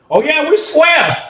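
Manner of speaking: high, slow drawn-out croon; old head's voice. Retold or imitated. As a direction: stretch it out high. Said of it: "He was like".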